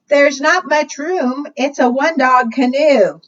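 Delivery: put-on a little girl unsure there's room; doubtful girl. Girl telling the beaver the canoe is full, mildly worried.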